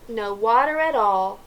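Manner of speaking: ordinary delivery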